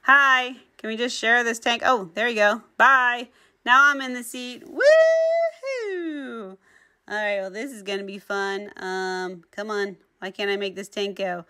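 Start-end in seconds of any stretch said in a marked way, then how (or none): 4.6-6.6 high, gleeful whoop; joyful cheer. Real, not acted. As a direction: cheer as the tank is yours. Cheers after taking tank seat.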